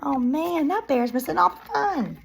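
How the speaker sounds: usual speaking voice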